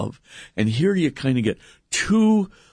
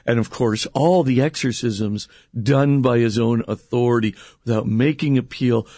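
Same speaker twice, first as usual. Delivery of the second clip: ordinary delivery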